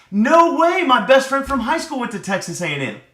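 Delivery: loud, high burst of delight; light up at the shared connection; excited. Acted. Acted excited small talk.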